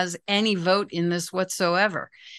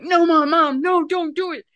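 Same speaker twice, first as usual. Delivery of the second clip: higher, louder mock plea from a scared child; pleading child; 8 semitones higher, 5 dB louder than usual. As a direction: beg mom not to risk it, half-played. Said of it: "the other one would be like".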